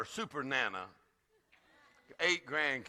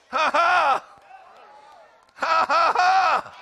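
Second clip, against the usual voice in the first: loud, high, shouted "ha, ha, ha"; deliberate exultant laugh; 12 semitones higher, 13 dB louder, slower than usual. Shown as a demonstration. Preacher rejoicing before the congregation, deliberate exultant laugh. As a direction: belt out a laugh of praise. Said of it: "Practice laughing for a few minutes".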